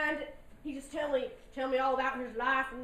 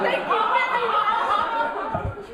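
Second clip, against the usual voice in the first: laughing thanks; 9 semitones higher, 12 dB louder, much slower than usual. Real, not acted. Thanking crowd for sympathy laughter.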